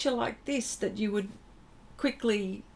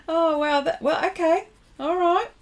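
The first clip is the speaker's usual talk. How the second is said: excited; 9 semitones higher, 6 dB louder than usual. Real, not acted. Guessing a place on the map.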